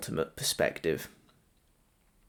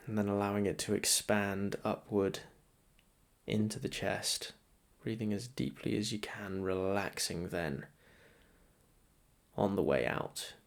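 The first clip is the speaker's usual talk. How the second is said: softer, measured meditation voice with long pauses; guided meditation; 7 dB quieter than usual. Acted. Meditation guide to listeners settling into breath practice, soft guided meditation. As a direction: guide them gently into noticing the breath.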